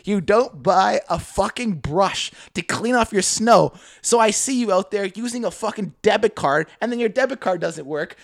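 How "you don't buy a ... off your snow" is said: slow emphatic. Real, not acted. Slowed exasperated accusation.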